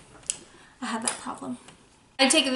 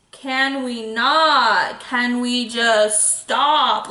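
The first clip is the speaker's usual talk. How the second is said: slow, drawn-out can we not; slow, loud, mock-fed-up plea; mock exasperation; 3 dB louder, much slower than usual. Real, not acted. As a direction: stretch it out in amazed disbelief. Overwhelmed by highlight glow.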